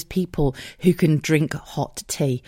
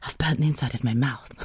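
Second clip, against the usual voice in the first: mock pained whisper; 3 semitones lower, 5 dB quieter than usual. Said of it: "and then was like".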